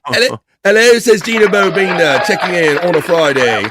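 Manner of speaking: high, loud sing-song hello, loves; imitating Gina's hello. Host mimicking a chatter's bubbly greeting, high and loud. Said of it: "She always likes to say hello".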